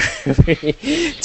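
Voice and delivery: high giggling laugh; burst of laughter. Real, not acted. Laughs at date jump.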